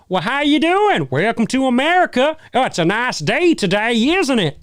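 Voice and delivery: high, twangy cartoon American y'all-and-howdy voice; mock American accent. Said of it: "the American accent".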